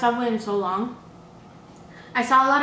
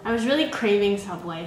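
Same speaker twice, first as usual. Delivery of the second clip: ordinary delivery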